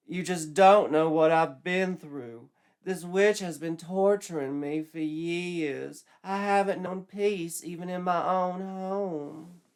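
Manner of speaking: put-on high, slow girlish voice; girlish Betsy. Betsy turning Joshua down, high and slow. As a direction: sigh him off like a teen girl.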